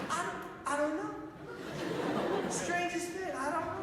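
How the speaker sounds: high-pitched, guileless imitation; sweet man. Sweet man explaining his fall to a helper, guileless. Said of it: "He's, like, the sweetest man".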